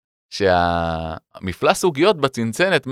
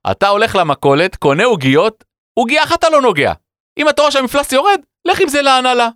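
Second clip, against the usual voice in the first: high, emphatic mock lecture; hammed-up colleague laying down rules; lecturing colleague; 13 semitones higher, 3 dB louder than usual. Colleague Bruno lecturing him. (חבר מטיף בקול גבוה מוגזם)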